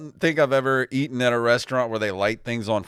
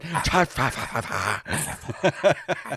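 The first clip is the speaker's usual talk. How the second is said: mimicking blowing on food; 4 dB quieter, much slower than usual. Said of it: "of him going".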